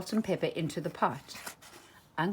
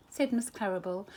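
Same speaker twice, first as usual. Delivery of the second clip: plain reading voice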